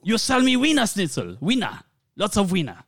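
high, insistent mock German; German accent.

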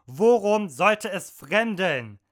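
loud, slow angry reply; shouting back slowly; angry retort. Acted. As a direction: shut her excuse down hard. Mother snapping at carer.

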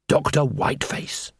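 hushed, whispery naming; whispered dread; ominous reveal. A narrator's reading. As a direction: breathe the name like a dreaded secret. Narrator reveals the dreaded clown.

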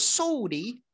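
put-on high, rising childlike "Sorry"; daughter's sorry. Imitating little Lily saying sorry, high and childlike. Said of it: "she goes".

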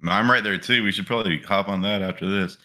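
ordinary talk, nothing marked

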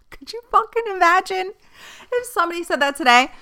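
high, fast words bubbling through real laughter; can't believe it, laughing it out; laughing in disbelief. Real, not acted. Vlogger to viewers, laughing at the quote. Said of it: "I'm laughing because".

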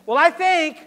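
high, loud, whiny mimic of a know-it-all; mocking quote. Preacher mocking people who push their own opinion, high loud whine. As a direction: lampoon the self-important opinion-giver. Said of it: "Doctrines of devils come when people say".

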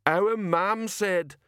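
very slow, high protest; big simple man appealing to mother's rule; slow-witted giant. A narrator's reading.

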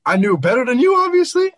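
loud, high-pitched comeback; indignant retort. Real, not acted. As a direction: fire back loudly, pitch climbing on the last word. Heated retort in argument.

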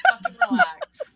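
laughing. Real, not acted. Teasing friends, laughing.

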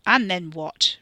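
loud snarling challenge. A narrator's reading. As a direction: snarl it at him. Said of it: "Ricky snarled".

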